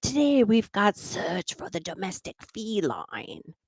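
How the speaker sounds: hammed-up Australian nature-show voice; Australian wildlife host. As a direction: play it like an excited Australian crocodile hunter. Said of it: "And I'd say".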